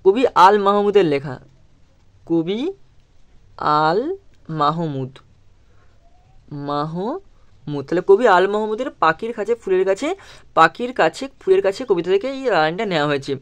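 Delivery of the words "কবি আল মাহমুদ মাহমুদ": slow dictation. Shown as a demonstration. Teacher spelling out the poet's name to pupils, slow and drawn out. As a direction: stretch the name out for the class to write.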